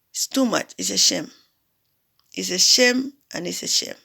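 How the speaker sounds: ordinary talk, nothing marked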